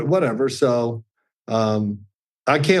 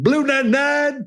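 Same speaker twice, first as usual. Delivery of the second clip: quarterback calling signals; 12 semitones higher than usual. Retold or imitated. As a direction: imitate your young self calling the play. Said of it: "You sound so funny".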